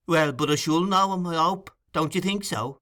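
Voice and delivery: Bolter's voice. Acted. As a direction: ask it hopefully, like a keen new recruit. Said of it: "said Mister Bolter".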